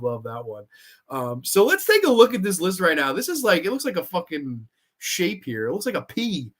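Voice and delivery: ordinary delivery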